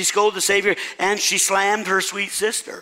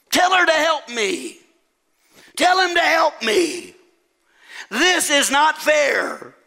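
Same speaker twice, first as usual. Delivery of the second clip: high, aggrieved demand; scolding; 9 semitones higher than usual. Acted. Martha demands that Jesus make her sister help, scolding. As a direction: whine of injustice, pitched way up. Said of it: "notice Martha scolded her Savior".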